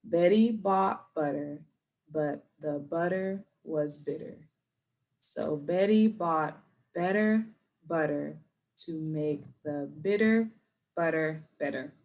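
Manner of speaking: slow, careful practice read; slow practice for students; slow again. Shown as a demonstration. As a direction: take it slowly with pauses. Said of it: "let's try it again".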